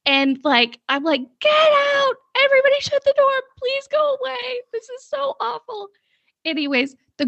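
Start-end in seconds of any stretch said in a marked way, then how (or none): 1.4-5.9 very high-pitched mock panicked yell, fading; retold yelling. Naked woman begging onlookers to leave the garage, mock yelling. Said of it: "I'm yelling".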